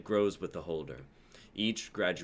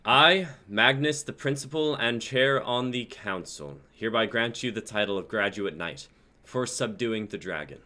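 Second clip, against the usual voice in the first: proclaiming council head; 4 semitones higher, 8 dB louder than usual. Acted. Council head addresses the crowd, loud and formal. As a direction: announce it grandly to the crowd. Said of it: "Magnus speaks to the crowd".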